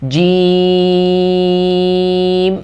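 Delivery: جیم held very long on the vowel; long jeem. Shown as a demonstration. Teacher showing the long madd on جیم, stretched recitation. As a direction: stretch جیم out slowly, sung. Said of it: "اس کو ہم نے لمبا کر کے پڑھنا ہے".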